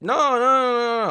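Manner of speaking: high, rapid string of no's; hurried refusal. Retold or imitated. Tourist turning down the dealer, high-pitched refusal.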